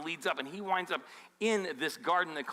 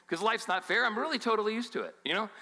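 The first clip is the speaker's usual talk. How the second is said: higher, quick mock acceptance; 6 semitones higher, 3 dB louder, faster than usual. Pastor mimicking an answer nobody gives, in mock acceptance. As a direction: toss it off as if totally used to it.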